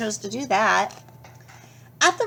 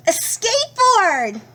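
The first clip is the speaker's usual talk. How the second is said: much higher and louder joyful exclaim; excited cry; 11 semitones higher, 5 dB louder than usual. Acted. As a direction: burst out with delight at the find. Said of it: "he cried".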